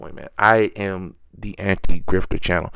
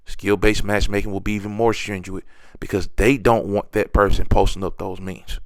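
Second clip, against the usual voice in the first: ordinary delivery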